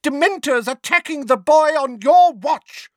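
high, loud accusation; shriek. A narrator's reading. Neighbour blaming Mundungus for the attack, shrieking. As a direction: shriek it in outraged blame. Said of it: "shrieked Missus Figg".

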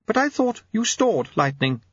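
high-pitched ladyship voice; high lady's protest; Lady Lejeune. Acted. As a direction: object in a high, put-on lady's voice. Lady Lejeune objects in character.

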